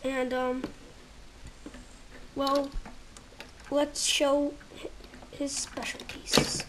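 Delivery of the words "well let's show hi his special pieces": usual speaking voice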